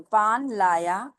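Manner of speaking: पान लाया repeated slowly after spelling; slow model पान लाया after spelling; slow model reading. Shown as a demonstration. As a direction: read पान लाया slowly again for learners. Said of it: "मैं पढ़ के सुनाती हूँ".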